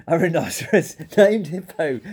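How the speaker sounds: laughing through the words; howling with laughter. A narrator's reading. Fluffy cries out the rhinoceros's name, laughing. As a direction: break into laughter mid-phrase. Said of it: "He howled with laughter".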